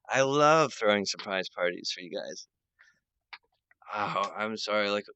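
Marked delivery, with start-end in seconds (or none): 0.1-2.5 loud cheer. Real, not acted. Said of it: "surprise party cheer".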